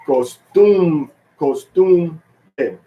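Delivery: "costumbre" punched high on TUM; one accented syllable. Shown as a demonstration. Teacher showing Spanish, "costumbre" with accent on TUM. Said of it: "put the accent and then one syllable".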